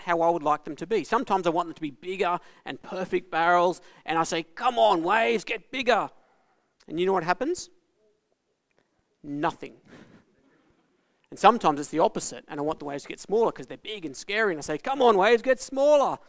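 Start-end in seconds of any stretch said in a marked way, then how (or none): 4.4-6.1 calling to waves. Retold or imitated. Calls out to the waves. 14.8-16.2 higher, louder plea to the sea; scared surfer calling out to the waves, higher and louder; pleading call. Retold or imitated. Calls out to the waves.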